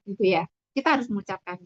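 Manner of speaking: ordinary talk, nothing marked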